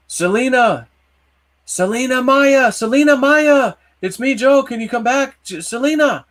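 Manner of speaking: high, loud calling out a name across the empty place. Retold or imitated. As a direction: shout for her to come back, drawing out Selena Maya. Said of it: "I start yelling out".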